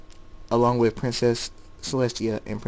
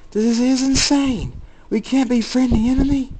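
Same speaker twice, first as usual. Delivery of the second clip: outraged shout; 13 semitones higher, 7 dB louder than usual. A narrator's reading. Guy refusing to befriend the enemy, outraged shout. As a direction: yell it in loud, high-pitched protest.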